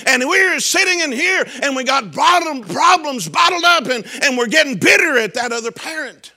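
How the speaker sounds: high, loud preacher's whoop; full-throated pulpit cry; preaching at full force. Real, not acted. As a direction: climb and shout it to the congregation.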